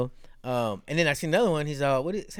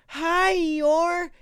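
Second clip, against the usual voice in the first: very high toddler greeting, imitated; drawn-out high-pitched kid voice; little kid; 14 semitones higher than usual. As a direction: squeal it like a small child. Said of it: "this little kid".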